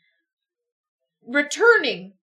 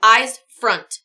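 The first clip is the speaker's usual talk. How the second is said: sharp command; 9 dB louder than usual. Acted. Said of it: "you instruct".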